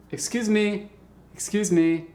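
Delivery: polite attention-getter. Shown as a demonstration. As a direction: demonstrate politely getting someone's attention. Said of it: "like that, you know".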